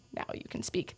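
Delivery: hushed sarcastic aside; sarcastic under breath. Retold or imitated. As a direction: mutter it dryly to yourself. Sarcastic aside about silent patient.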